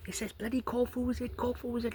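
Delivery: high, strained mock rant; exasperated high-pitched yell; exasperated outburst. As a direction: throw your hands up at him. Friend exasperated over hat.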